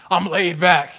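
loud, slow, emphatic insistence; insistent comic protest. Acted. Restless husband insisting he is relaxed, loudly. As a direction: belt it out, undercutting the claim.

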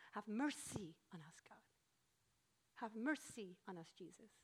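hushed, low plea standing in for a yell; mock distant yell. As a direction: beg softly, near a whisper. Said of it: "here they yell".

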